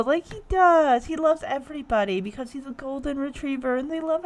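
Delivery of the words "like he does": normal talking voice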